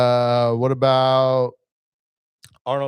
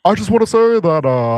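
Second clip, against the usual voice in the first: higher, louder podium voice; measured politician with filled pauses; Barack Obama; 5 semitones higher, 4 dB louder than usual. Acted. Host speechifying as a president, higher podium impression. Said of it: "Barack Obama?".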